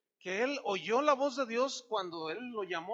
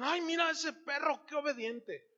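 mock gushing admiration; 6 semitones higher than usual. People marvelling at trained dog.

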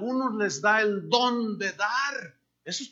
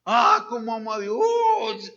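slow, hammed-up pious sigh, a bit louder; mock piety; 3 semitones higher, 5 dB louder than usual. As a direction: parody a showy believer. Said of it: "algunos cristianos metidos en las iglesias". (creyente falso con devoción exagerada y lenta)

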